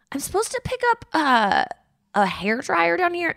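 high and soft, put-on politeness; mock sugary voice at the desk; fake-sweet request. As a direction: ask sweetly through clenched niceness. Sweet voice to rude clerk.

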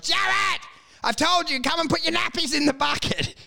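high, shouted "Jared", called down the street; mock yell. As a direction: holler it like a furious father. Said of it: "He yelled".

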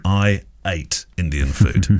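I stressed, said slowly with a rising end. Shown as a demonstration. Host jokingly stressing that he only ate the food, said slowly. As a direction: lean on I, slowly, as the joke of who only ate. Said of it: "let me rephrase that".

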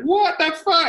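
very high-pitched mock outrage; shocked John Richardson. Friend reacts to the stabbing in the bar, high-pitched shock. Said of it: "John Richardson was like".

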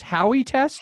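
rising, unsure question about the name; puzzled question. Real, not acted. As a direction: ask it back, unsure of the word. Asking to confirm a name.

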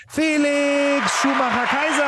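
laut hochgezogenes Rufen des Namens; announcer hype. Acted. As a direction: den Gast wie ein Ringsprecher ankündigen. (Ringsprecher-Ansage)

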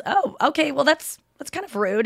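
mildly affronted, halting voice; taken-aback objection. Retold or imitated. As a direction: politely call out rudeness. Anya objects politely to rudeness.

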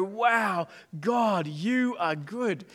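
slow, hushed awe at God's goodness; awed wonder. Pretend. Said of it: "might stand back and go".

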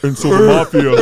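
loud growling monster voice. Retold or imitated. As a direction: boom it out like a monster. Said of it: "And my monster voice".

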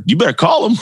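high-pitched, pushy urging; insistent wife. Retold or imitated. Wife urging her nervous man to phone, high and insistent.

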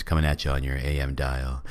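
mock radio host introducing tonight's band; AM radio personality voice. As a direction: roll it out like a late-night rock DJ. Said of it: "drift into the A M radio personality voice".

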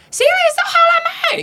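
high, far-off call from the next room; mother calling. Retold or imitated. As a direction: mom calling out from another room. 妈妈隔壁房间问话. (妈妈从隔壁房间高声喊问)

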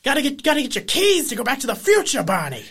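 fast, high-pitched frantic exclaiming; hammed-up urgency in an invented accent; Christopher Lloyd. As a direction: play up the excitable movie-scientist impression. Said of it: "kind of morphed into that".

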